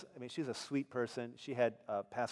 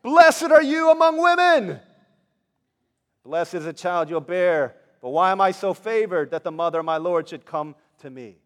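loud exclamation; 6 semitones higher, 17 dB louder than usual. Acted. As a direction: proclaim it joyfully at full voice. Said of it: "in a loud voice, she exclaimed".

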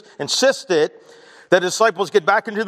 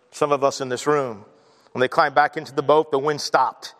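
usual speaking voice

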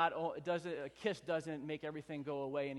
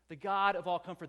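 "God of all comfort" repeated louder, stress on "God"; emphatic repeat; 3 semitones higher, 8 dB louder than usual. Shown as a demonstration. Pastor repeating "God of all comfort" to the congregation, emphatic. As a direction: lift it and punch "God". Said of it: "He says that he is the God of all comfort".

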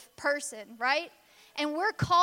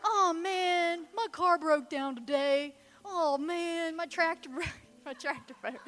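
higher, whiny mock moping; mock a self-pitying whiner; a hammed-up moper; mopey complainer; 3 semitones higher than usual.

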